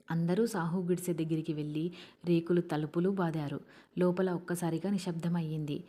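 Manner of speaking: ordinary delivery, nothing marked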